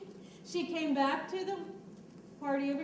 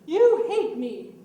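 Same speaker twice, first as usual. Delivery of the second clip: loud accusing; 12 dB louder, slower than usual. Shown as a demonstration. Teacher demos wife yelling at Samson.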